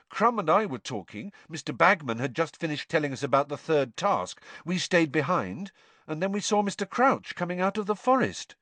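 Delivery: high-voiced, pressing explanation; Harry, recounting. A narrator's reading.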